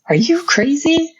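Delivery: shoots way up high in disbelief; incredulous squeal. Retold or imitated. Telling the Lord his idea is crazy, high incredulous squeal. Repeats it, much higher.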